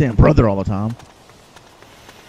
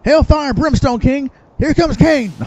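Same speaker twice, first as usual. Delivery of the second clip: announcer hype; 13 semitones higher, 6 dB louder, slower than usual. Pretend. Announcing a boss dramatically.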